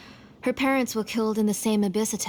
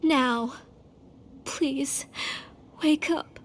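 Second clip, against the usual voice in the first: pleading; 3 semitones higher, slower than usual. Acted. Mother begging child to wake.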